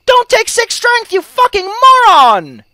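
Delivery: slow, high-pitched yell of disbelief; exasperated scolding. Acted. Scolding a player who dumps strength, exasperated high yell.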